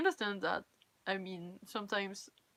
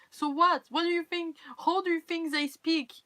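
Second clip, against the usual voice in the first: loud, higher, indignant comeback; indignant and raised, arguing back; exasperated retort; 7 semitones higher, 8 dB louder than usual. Acted. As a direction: fire back at the mockers, loud and high. Indignant retort to mockers of accents.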